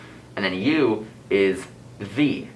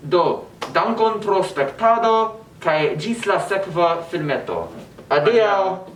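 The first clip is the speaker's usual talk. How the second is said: slow, high, happy farewell; cheery sign-off; 9 semitones higher, slower than usual. Real, not acted. Host thanking viewers and signing off, cheery and higher. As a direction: wave goodbye with the voice.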